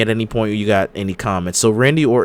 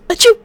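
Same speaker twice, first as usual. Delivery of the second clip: fake sneeze; 8 dB louder than usual.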